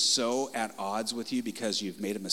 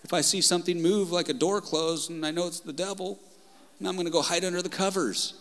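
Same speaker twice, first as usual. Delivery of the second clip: high-pitched, playful show of fear; mock frightened believer; 7 semitones higher, faster than usual. Preacher voicing someone afraid of the devil, mock fearful. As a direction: act lightly scared, pitched well up. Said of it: "You might think that".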